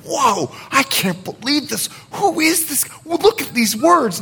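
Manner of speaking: high-pitched, incredulous gasp of wonder; acted astonishment, pitched high; amazed crowd. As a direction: react as a stunned onlooker, voice way up. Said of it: "as the people are going".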